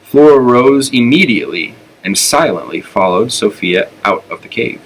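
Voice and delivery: plain reading voice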